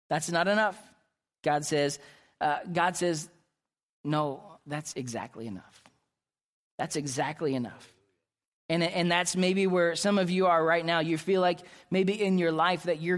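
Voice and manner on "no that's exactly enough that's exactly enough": calm voice of God. Acted. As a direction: answer the panic with quiet certainty. God's calm answer.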